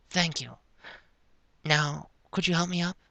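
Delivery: high, soft character voice; dazed character. A narrator's reading. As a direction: ask softly in a high voice. Same dazed character asks for help.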